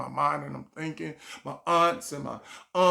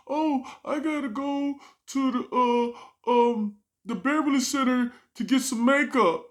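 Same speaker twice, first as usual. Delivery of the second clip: put-on girlfriend; 9 semitones higher, 4 dB louder than usual. Girlfriend voiced in story.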